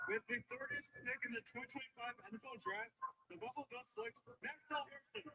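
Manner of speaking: formal pick announcement, rising; draft announcer. Real, not acted.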